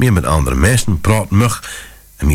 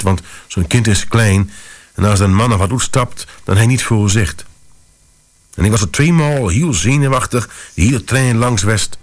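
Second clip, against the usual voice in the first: plain reading voice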